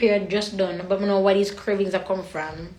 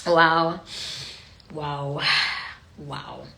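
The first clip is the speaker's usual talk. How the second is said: emotional; 7 semitones lower, much slower than usual. Real, not acted. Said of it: "You're making me all emotional".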